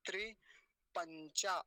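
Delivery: त्रिपंचा broken slowly into tri, pan-chā; split-word model. Shown as a demonstration. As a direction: separate the parts of त्रिपंचा. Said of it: "विग्रह बना देता हूँ".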